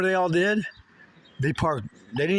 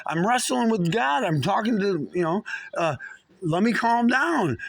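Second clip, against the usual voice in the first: pleading yell; 6 semitones higher than usual. Retold or imitated. Man retelling how he yelled at the police to leave him alone. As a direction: push them back with your voice, pleading. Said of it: "I said".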